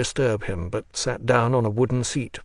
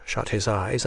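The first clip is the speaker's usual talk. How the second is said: plain reading voice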